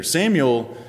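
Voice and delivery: ordinary delivery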